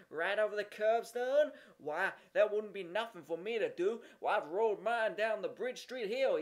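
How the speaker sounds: put-on outdo the other kid; bragging boy. A narrator's reading.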